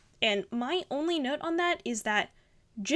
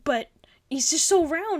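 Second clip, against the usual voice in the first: admiring delight; 3 semitones higher, 7 dB louder than usual. Real, not acted. Admiring the round character.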